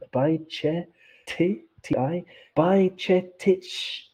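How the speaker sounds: halting teacher-style by che, tich in separate chunks; sounding out syllables. Shown as a demonstration. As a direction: sound out "bye-cheh-titch" one syllable at a time. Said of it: "So it's by ch".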